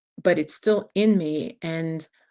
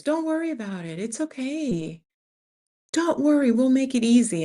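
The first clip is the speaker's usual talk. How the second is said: much higher, singsong mock reassurance; breezy brush-off; 7 semitones higher than usual. Imitating the contact who keeps saying don't worry, high singsong. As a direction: wave her worries away in a high lilt. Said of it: "And they're just like".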